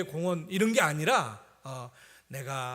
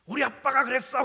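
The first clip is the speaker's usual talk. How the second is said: put-on high, loud proud kid's voice; confident child; 8 semitones higher, 7 dB louder than usual. Son before everyone, confident high child voice. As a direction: say it boldly like a sure little boy. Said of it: "당당하게".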